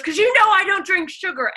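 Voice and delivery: high, loud whiny demand; imitating Ellen. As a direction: whine it like a spoiled star. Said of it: "She goes".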